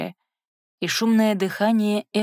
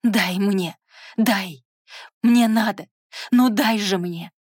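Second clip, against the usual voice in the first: louder pleading cries, rising to a shout; desperate yelling; 2 semitones higher, 4 dB louder, slower than usual. Acted. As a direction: beg frantically, at full volume. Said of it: "завопила во всю глотку".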